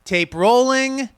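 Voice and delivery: tape rolling, drawn out slowly; slow set call. Shown as a demonstration. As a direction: announce it to the crew slowly. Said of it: "what is it?".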